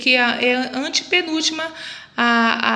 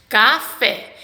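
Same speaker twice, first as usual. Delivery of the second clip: café split into ca and a stressed fé; stress on final syllable. Shown as a demonstration. Teacher shows the last syllable of café, stressed on fé. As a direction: sound out café in two clear syllables, weight on fé. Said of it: "A última sílaba é".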